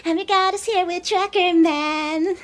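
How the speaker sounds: high, bright host intro; YouTube intro voice. Pretend. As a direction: greet viewers like a hyped YouTuber. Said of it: "All right, YouTube intro".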